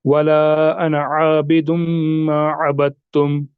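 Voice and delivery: slow, measured recitation with long vowels; drawn-out verse reading; Quran recitation. Shown as a demonstration. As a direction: recite it slowly, "अबदतुम" merged as "abat-tum". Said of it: "जैसे सूरह काफिरून में हम पढ़ते हैं".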